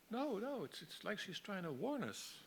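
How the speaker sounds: high-pitched character voice, objecting; acted high-voiced protest, trying to get through; protesting woman. Acted worried Linda objects.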